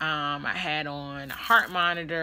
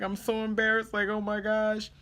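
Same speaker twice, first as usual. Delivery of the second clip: higher-pitched mock-tearful voice; crying, embarrassed; 5 semitones higher than usual. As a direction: act out a mild embarrassed whimper. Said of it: "I'm crying".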